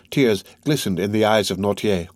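ordinary delivery

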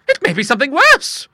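high, loud ominous warning; hint at something darker; grim old lady. Acted. Monica warning Bertha, grim but high.

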